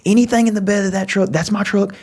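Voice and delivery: high, eager voice of a caught man; high scared giveaway. Retold or imitated. Caught dude to Frankie, high scared offer. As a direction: hand it all over fast and scared.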